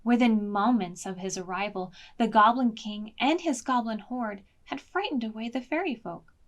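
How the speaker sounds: plain reading voice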